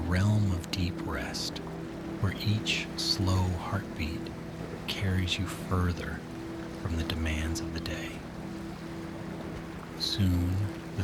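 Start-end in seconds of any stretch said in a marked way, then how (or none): none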